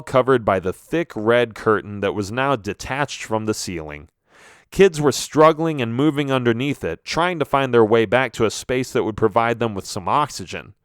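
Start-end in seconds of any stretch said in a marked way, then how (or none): none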